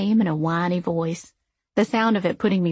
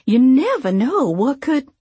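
too eager; 5 semitones higher, 7 dB louder, slower than usual. A narrator's reading. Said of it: "a little too eagerly".

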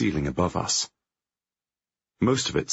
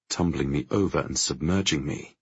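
ordinary delivery, nothing marked

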